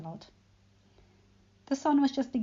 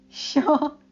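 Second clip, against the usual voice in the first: giggling; 6 semitones higher, 10 dB louder than usual. A narrator's reading. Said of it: "giggling at the little dragon".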